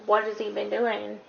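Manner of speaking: ordinary delivery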